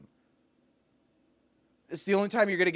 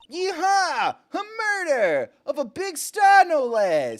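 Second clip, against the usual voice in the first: high, loud southern whoop; gleeful yeehaw; 12 semitones higher, 5 dB louder than usual. Acted. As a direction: whoop with delight. Lotta cheering over murder.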